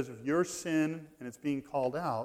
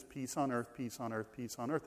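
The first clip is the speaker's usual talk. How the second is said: repeated slogan, dropping away softer; mimicked pet phrase; 4 semitones lower, 10 dB quieter than usual. Preacher mimicking those who only want peace, softly parroting.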